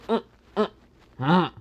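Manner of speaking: high hummed protest; muffled mumbling. Acted. Issei with Valerie's hand over his mouth, muffled mumbling. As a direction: mumble loudly through a closed mouth.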